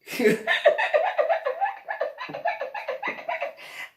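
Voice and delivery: high cackling laughter, running on; helpless fit of giggles; real long laugh. Real, not acted. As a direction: lose it completely at your own story. Laughing at own story.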